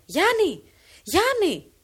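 loud, high repeated call. Acted. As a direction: shout his name into the distance. Calling across the fields.

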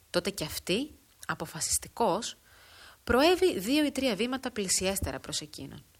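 ordinary delivery

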